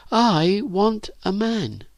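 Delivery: put-on slow, high girl's voice; longing declaration. A narrator's reading. Virginia tells Phyllis what she wants, longing. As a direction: draw it out, high and yearning.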